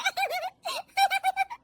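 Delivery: high giggly laugh; high-pitched tittering. Acted. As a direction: giggle in delight. Daughter-in-law laughing at TV.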